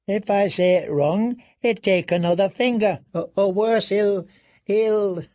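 high, faltering, frightened; panicked stammer. A narrator's reading. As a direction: stammer in dread. Prisoner panics about punishment.

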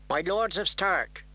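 high, slow ceremonial address; formal young envoy. Acted. Kneeling girl addressing the lords of Stark, formal. Girl pledging before the dais.

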